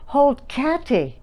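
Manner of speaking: lightly voiced toddler copying. Shown as a demonstration. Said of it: "And Susie says".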